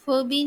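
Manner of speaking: "फ़बी" as one word with a rounded vowel, foh-bee; wrongly read as word. Shown as a demonstration. Teacher trying another wrong reading for students, foh-bee as one word. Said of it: "as a word pronoun-, pronounce नहीं करते".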